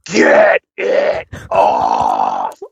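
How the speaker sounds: very low, drawn-out demon growl; demonic voice. Retold or imitated. Retelling a partner's sudden possession, slow demon voice from hell. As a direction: stretch it out in a mock possessed voice, far below your own. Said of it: "voice that came out from the depths of hell".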